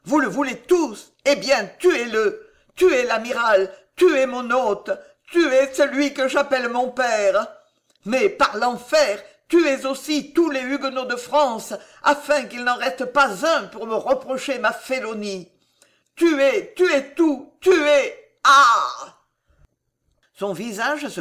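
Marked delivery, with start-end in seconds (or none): 0.0-18.1 high, loud frantic orders; overwrought, shouted command to kill; panting frenzy. A narrator's reading. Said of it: "haleta Charles neuf". 18.4-19.2 loud, high scream; crazed shout at breaking point; frenzied cry. A narrator's reading. King's frenzy ends in a cry.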